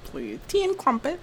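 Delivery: high, light British parody; mock British accent. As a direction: put on a silly high British voice. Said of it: "Oh, it's some British shit".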